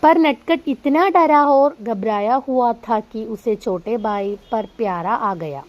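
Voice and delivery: slow, deliberate read-aloud; teacher reading a story to students. Acted. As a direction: read each word out carefully for the class. Reads Hindi story aloud.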